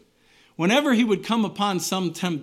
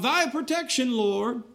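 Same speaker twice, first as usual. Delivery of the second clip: fervent cry; 7 semitones higher than usual. Retold or imitated. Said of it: "you can cry out".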